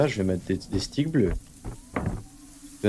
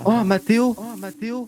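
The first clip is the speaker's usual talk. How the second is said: singsong calling; 12 semitones higher than usual. Pretend.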